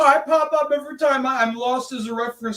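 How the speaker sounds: high, loud [name] impression; playing [name]. Improviser playing [name] to a fan. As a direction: play [name] as a diva.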